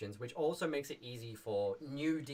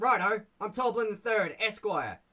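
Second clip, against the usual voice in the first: higher, louder goblin calling out to his mate; Toblin voice; 8 semitones higher, 8 dB louder than usual. Shown as a demonstration. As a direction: bark it across the bridge like a grumpy goblin. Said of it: "he's like".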